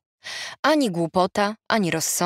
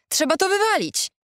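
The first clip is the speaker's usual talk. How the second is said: high-pitched, insistent demand; emphatic exclamation; 12 semitones higher than usual. A narrator's reading. Friend urging Ada to clear the house, emphatic.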